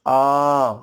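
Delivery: long aa sound, आ drawn out long and slow; letter sound aa. Shown as a demonstration. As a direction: stretch आ into a long open vowel. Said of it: "इसकी आवाज़ आती है".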